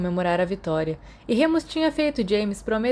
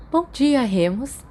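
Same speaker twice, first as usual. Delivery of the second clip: slow, higher motherly greeting; kindly nurse; 6 semitones higher, 3 dB louder, slower than usual. Acted. Nurse greeting the hurt boy, slow high gentle voice.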